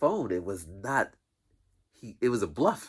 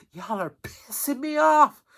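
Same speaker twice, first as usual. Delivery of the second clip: much higher, louder and slow mock scolding; imitating the office lady; 7 dB louder than usual. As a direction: drag it out like an annoyed lady scolding kids.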